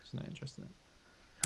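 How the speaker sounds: unvoiced aside, very soft; whispering. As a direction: whisper it like a secret. Repeats line whispered.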